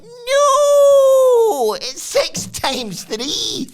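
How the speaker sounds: very high, loud squeal of no; mock squeal. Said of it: "Noam's like".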